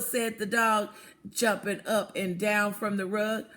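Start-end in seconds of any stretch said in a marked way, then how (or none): none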